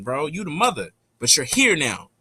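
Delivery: usual speaking voice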